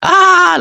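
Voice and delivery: sudden very high loud laughing shout; shouted laugh burst. Shown as a demonstration. Teacher acting a huge laugh at someone slipping, loud shout. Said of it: "like that".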